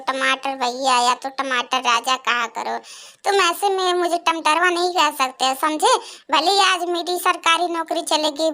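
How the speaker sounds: ordinary delivery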